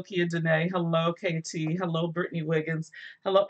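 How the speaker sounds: usual speaking voice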